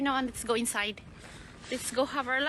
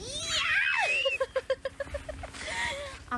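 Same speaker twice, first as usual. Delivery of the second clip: squealing laugh. Real, not acted. Toddler playing in the snow.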